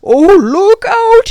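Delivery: very loud, high, slow warning cry; urgent cry. A narrator's reading. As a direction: cry out to save the boys. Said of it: "he cried".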